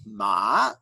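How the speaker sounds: Ma sliding upward like a question; second tone Ma; rising tone. Shown as a demonstration. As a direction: ask Ma with a rise. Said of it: "When you sing it that way".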